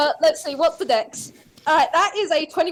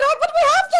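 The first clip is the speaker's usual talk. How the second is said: high pleading lady; 13 semitones higher, 4 dB louder, much faster than usual. Retold or imitated. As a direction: plead with him. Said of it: "And I went".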